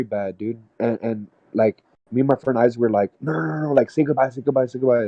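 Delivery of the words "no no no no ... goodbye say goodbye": scared pleading. Retold or imitated. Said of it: "we were super scared".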